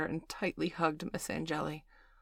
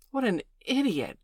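chiming in; 6 semitones higher, 3 dB louder than usual. Acted. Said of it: "chimed Bailey".

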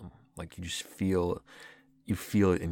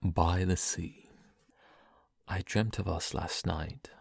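poem reading. Acted. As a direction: read it like a tender poem. Reads a poem aloud.

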